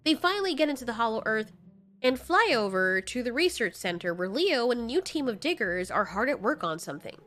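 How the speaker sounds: ordinary talk, nothing marked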